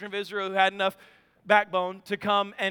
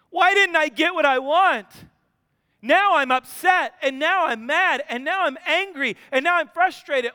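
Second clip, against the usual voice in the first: put-on loud, higher childish tantrum prayer; whiny child praying; 4 semitones higher, 6 dB louder than usual. Believer praying like a demanding child, loud whine. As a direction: whine at God like a spoiled kid. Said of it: "Are we the kid that says".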